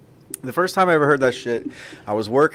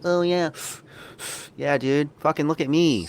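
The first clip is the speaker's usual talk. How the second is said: higher, airy show-off voice; hoity-toity smoker; 5 semitones higher, 4 dB quieter than usual. Retold or imitated. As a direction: prance like a smug smoker. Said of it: "hoity-toity, like".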